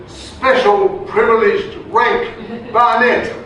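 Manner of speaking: slow, high, grand posh English pronouncement; toffee voice. Retold or imitated. Soldier explaining his rank to MPs in a slow grand posh English accent. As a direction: spell out the title like an English lord. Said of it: "he puts on this voice".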